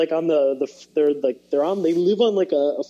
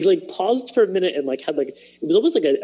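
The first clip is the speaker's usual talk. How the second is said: normal talking voice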